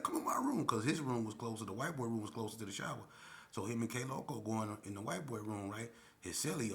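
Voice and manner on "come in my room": mock invitation. Retold quarrel.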